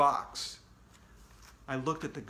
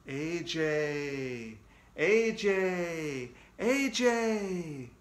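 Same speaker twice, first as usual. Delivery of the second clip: spooky ghost voice; much slower than usual. Acted. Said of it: "I heard another spooky voice".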